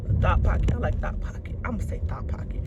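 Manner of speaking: low, soft muttering to herself; muttered wordplay. Acted. Playing with her own joke, muttered low and soft. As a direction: turn the phrase over under her breath.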